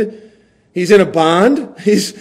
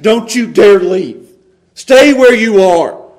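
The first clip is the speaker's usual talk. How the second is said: louder, slow, firm; stern insistence; 3 semitones higher, 8 dB louder, slower than usual. Acted. Paul firmly telling Timothy to stay, slow and stern. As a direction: put your foot down.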